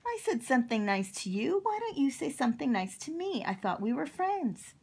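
fast, high-pitched hurt protest; indignant. Acted. Octopus scolding Cowboy for not being nice, indignant. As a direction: complain quickly, voice lifting in offence.

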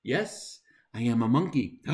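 plain reading voice

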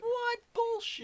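very high, loud, slow outrage; outraged squeal. Real, not acted. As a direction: cry out in disbelief. Outraged at not grabbing guns.

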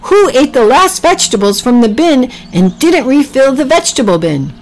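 high, huffy accusation; indignant huff. A narrator's reading. Priscilla finds the vegetable bin empty, huffing indignantly. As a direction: huff it out, pitched high at first. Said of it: "Priscilla huffed".

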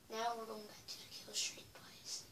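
soft unvoiced whisper. Real, not acted. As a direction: hush it right down. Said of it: "I'm being as quiet as I can".

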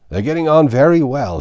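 high, loud, choked-up reply; choking voice. A narrator's reading. Hadda answers with a mouthful of bread, choking. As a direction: force it out through a full throat. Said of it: "in a choking voice".

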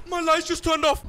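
panicked shout as the room goes dark. Real, not acted. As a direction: yell it out in alarm. Lights suddenly go out.

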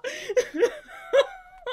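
loud high laugh breaking into sobs. Real, not acted. Reader overcome by the scene, laughing through tears. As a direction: laugh and cry at once.

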